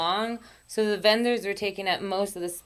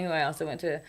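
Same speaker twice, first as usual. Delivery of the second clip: ordinary delivery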